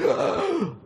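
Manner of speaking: loud, high mock gasp; shocked gasp. Crowd seeing Lazarus stagger out, shocked gasp. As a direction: gasp sharply like an astonished onlooker.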